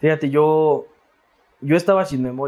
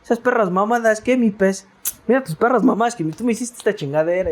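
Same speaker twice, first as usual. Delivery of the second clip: higher, rowdy swearing buddy; rough street guy cursing; 7 semitones higher than usual. Retold or imitated. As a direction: bark it like a foul-mouthed street friend. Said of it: "me dice".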